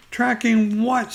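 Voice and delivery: high, small piglet voice; Piglet's little voice. Acted. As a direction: squeak it like a tiny piglet. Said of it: "said Piglet".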